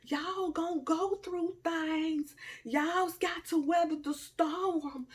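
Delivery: high, slow preachy drawl; mock sage advice. A high, slow sermon voice advising her followers. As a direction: preach it slow like a wise auntie.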